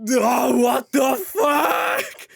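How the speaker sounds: loud, high, very slow disbelieving yell; shocked shout. Retold or imitated. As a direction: yell it slowly, outraged at the price.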